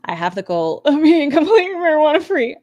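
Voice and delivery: high, loud, laughing parody of her line; a fellow streamer mimics her vow, giggling mockery; mocking impression. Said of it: "You're, you're really selling it".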